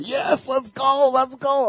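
high, slow cheering from the back seat; dead relatives cheering the driver on, high and slow. Retold or imitated. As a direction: egg him on like a cheering fan. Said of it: "rooting me on".

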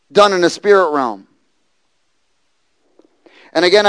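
loud preached scripture; fervent preaching. Acted. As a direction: proclaim the verse boldly.

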